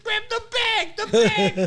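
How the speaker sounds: high, loud, laughing yell; urgent shout. Retold or imitated. Telling friends to grab the money bag, yelling and laughing. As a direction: shout it to a friend mid-escape.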